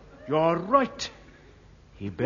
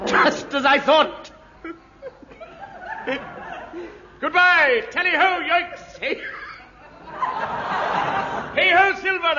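hammy shouting; 10 semitones higher than usual. Acted. Inspector rides off calling.

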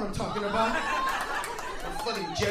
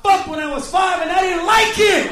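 high, loud blurted yell; angry shout; 6 semitones higher, 13 dB louder than usual. Retold or imitated. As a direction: scream the punchline out. Punchline yelled.

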